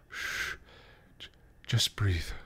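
soft, whispered hush; soothing. Acted. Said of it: "Tara soothed".

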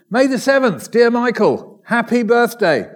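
sing-song it out like a birthday wish; bright, high festive greeting; cheerful birthday greeting. Acted.

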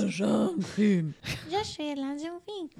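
high, slow French lesson voice; French commercial line. Retold or imitated. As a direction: recite it like the Muzzy ad in French. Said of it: "That fucking commercial".

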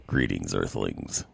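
deep, slow alien greeting; low, drawn-out spaceman voice. Pretend.

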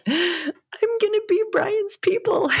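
high, slow, choked-up voice; tearful promise, voice cracking high; emotional, near tears. Retold or imitated. Telling husband Alex about Brian, slow and tearful. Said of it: "getting all emotional to Alex".

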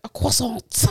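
slow, put-on French pronunciation; English speaker's showy French accent; mock French accent. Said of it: "they put a French".

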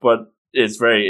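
normal talking voice

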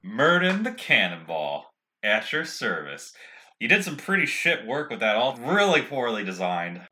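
normal talking voice

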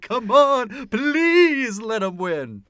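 loud, high, drawn-out mock plea; pleading cheer. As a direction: beg the horse home, stretching the words. Acts out ticket seller cheering mid-race.

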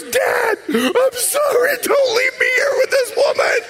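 put-on terrified boy wailing through laughter; panicked child. Son begging his dad not to leave him with the woman, shrieking. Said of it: "And he goes".

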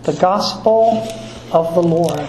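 slow, solemn proclamation; liturgical acclamation. Acted. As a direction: intone it like a priest at Mass.

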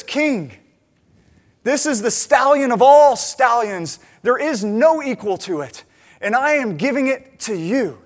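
loud, ceremonious, self-important nobleman; grand presentation. Retold or imitated. Nobleman presenting a stallion to the king, grandly. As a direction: proclaim it slowly and grandly, voice lifted.

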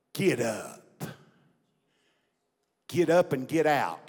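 low, measured command from an unseen voice; a hint of God's voice, spaced out; lightly voiced divine voice. Unseen voice to a jailed pastor, lightly voiced command. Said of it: "heard an audible voice".

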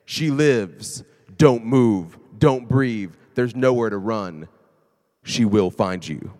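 loud, slow, ominous trailer read; movie trailer voice. Pretend. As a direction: boom it out like a horror trailer. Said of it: "just to read it".

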